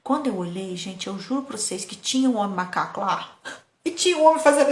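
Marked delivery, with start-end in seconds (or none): none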